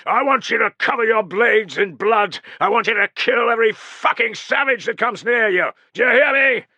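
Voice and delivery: high, loud, savage battle rally; roared exhortation. Acted. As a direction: fire the men up for slaughter. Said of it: "Caedicius roared".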